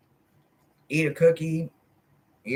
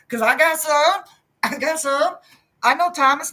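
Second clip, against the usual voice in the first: playful high confession; 13 semitones higher, 4 dB louder than usual. Real, not acted.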